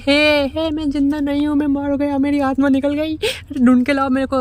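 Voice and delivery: high, panicky cry that his soul has left; panicked fear. Retold or imitated. Man believing his soul left his chest, panicking high. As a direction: squeal it out in comic terror. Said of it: "भाई डर जाता है".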